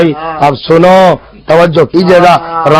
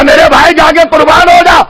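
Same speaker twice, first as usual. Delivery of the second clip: high, ringing cry; impassioned devotion; 9 semitones higher than usual. Acted. Hur urging his brother to sacrifice himself, impassioned devotion. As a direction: call it out high and fervently.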